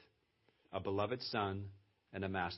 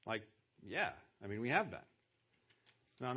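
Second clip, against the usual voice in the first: ordinary talk, nothing marked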